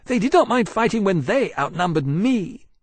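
high-pitched, indignant dragon voice. A narrator's reading. Fiery dragon about the enemies who fled, indignant. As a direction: complain hotly in a high voice.

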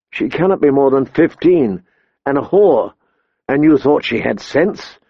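ordinary delivery

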